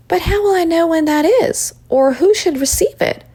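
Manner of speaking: put-on high-pitched, questioning young voice; Rachel, puzzled child. A narrator's reading. Rachel asks Esther what to do, puzzled and high. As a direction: ask it high and unsure.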